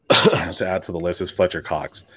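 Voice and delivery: usual speaking voice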